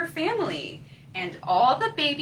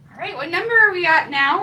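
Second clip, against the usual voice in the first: usual speaking voice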